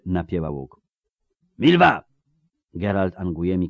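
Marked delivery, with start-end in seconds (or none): 1.6-2.0 loud, high shouted name; urgent call. A narrator's reading. Geralt calling archer from the arcade, shouting. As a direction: shout to pull her away.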